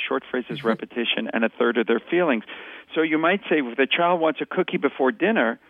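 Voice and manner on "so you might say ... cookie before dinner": ordinary talk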